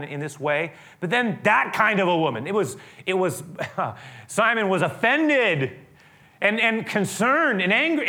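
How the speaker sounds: loud, high, impassioned preaching; preaching at full force. Real, not acted. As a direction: drive it home with fervor. Preacher builds to climax.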